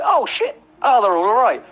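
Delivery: surprised. Retold or imitated. Retelling shock at big guys.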